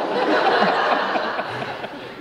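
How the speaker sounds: loud burst of laughter; big laugh. Real, not acted. Comic laughing at his own bit. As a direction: crack up loudly. Performer cackles at own joke.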